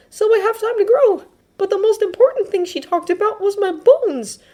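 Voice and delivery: put-on very high, earnest boy's voice; embarrassed Midoriya. Midoriya explaining the nurse's diagnosis to friends, embarrassed. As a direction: explain it high and a little loud.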